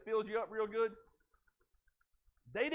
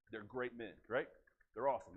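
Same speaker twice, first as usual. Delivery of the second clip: usual speaking voice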